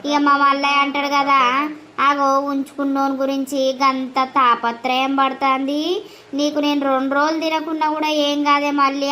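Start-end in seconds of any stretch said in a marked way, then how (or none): none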